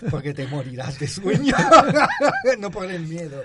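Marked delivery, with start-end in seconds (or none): 1.6-2.5 risa muy alta y fuerte; ríete a carcajadas, agudo y fuerte; carcajada aguda. Real, not acted. Risa tras broma del sueño. (carcajada aguda y fuerte)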